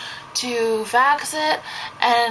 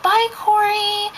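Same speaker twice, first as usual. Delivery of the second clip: put-on kids' singsong goodbye; 11 semitones higher, slower than usual. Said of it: "the kids are like".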